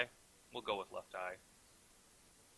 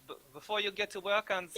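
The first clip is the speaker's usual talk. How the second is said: bad French accent, higher pitched; attempted French accent; 8 semitones higher than usual. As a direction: botch a French accent that drifts into German.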